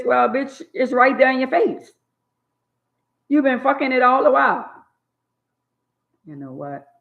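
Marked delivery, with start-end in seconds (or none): 0.0-4.7 higher, louder and sharp; scornful scolding. Real, not acted. Scolding women who complain after cheating, scornful. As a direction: tear into her with contempt.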